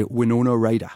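Winona Ryder in a Geordie lilt; Geordie accent. Shown as a demonstration. Teaching the trick into Geordie, in a Geordie accent. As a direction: say the name with a Newcastle twang. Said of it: "Is you just say".

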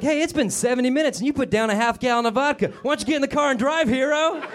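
mock radio announcer; hammed-up ad pitchman. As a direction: sell drunk driving like a cheesy radio ad. Voicing the liquor ad subtext.

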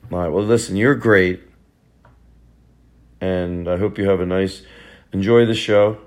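ordinary talk, nothing marked

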